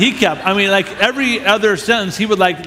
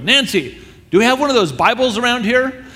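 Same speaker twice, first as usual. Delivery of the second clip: mild mock yell, pitched up; imitated call-out; 7 semitones higher than usual. Rock star calling to his housekeeper for a Bible, mock yelling. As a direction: call through the house without full volume. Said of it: "he yells at his".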